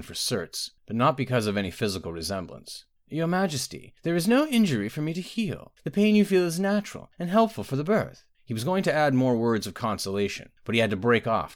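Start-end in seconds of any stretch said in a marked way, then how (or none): 3.1-8.3 high-pitched healer consoling a queen; placating healer. Acted. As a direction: console her in a raised, high voice.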